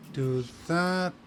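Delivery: usual speaking voice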